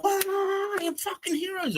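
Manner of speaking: very high gushing praise; high, mocking adoration; admiring crowd. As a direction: gush like an awed passenger. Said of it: "everyone's just like".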